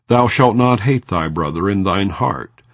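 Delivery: usual speaking voice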